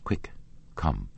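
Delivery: quick, hushed urgent words; hushed urging. A narrator's reading. Christine hurrying Raoul to her dressing room, hushed urging. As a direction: urge him along under your breath. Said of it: "She said".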